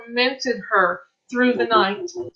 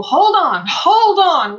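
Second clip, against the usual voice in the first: loud, higher, urging them to hang on; rally a struggling friend, louder and higher; urgent encouragement; 6 semitones higher, 7 dB louder, much slower than usual. Retold or imitated. Support group urging members to hold on, loud and high. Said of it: "the Facebook group is to encourage people".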